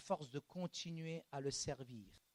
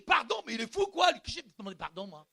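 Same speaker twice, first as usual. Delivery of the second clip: indignant shout; 6 semitones higher, 9 dB louder than usual. Retold or imitated. Moi, je lui dis.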